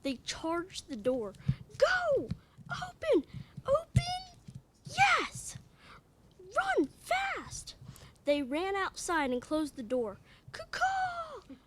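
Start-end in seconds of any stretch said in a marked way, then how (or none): 1.8-7.8 high, loud frantic shouts; panicked yells. Acted. The kids yell frantically as they flee the horror room. 10.5-11.5 high squawking bird call, kaw kaw; hawk cry. Acted. Child reader squawks the mama hawk's call.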